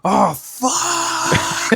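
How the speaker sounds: high, strained mock wail of outrage; high-pitched tantrum impression; mock raging. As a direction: imitate him losing it, drawn out and high. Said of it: "like, raging".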